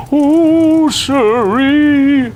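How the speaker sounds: very high, loud cow cry; frightened cow wailing in the sputtering plane; Cow's voice, alarmed. Acted. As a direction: cow yelping as the plane judders. Cow's catchphrase voice.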